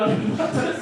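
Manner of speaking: ordinary talk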